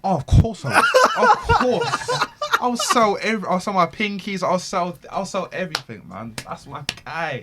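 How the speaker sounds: high, loud laughing rave; laughing gush. Real, not acted. Gushing about bailing out his idol.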